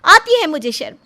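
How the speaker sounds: high, loud, defiant admission; angry outburst. Acted. Wife lashes out, admitting her shame. (गुस्से में चिल्लाकर कबूल करते हुए)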